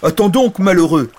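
loud, high dragon protest; hammed-up exasperated dragon; exclaiming dragon. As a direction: stop the weeper with a loud interruption. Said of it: "dit le dragon".

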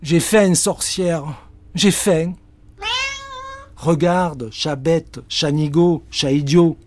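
hammed-up greedy cat; whining cat. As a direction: beg again for food. Cat whines, witch scolds.

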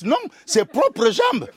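usual speaking voice

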